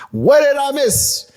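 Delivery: high, loud, drawn-out title call; hyped title shout. Acted. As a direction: belt out the title slowly with hype. Repeats the song title for emphasis.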